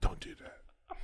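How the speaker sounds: put-on hushed, voiceless warning to a child; whispered warning. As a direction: re-enact a menacing whisper to a pupil. Retelling warning to lifted first grader.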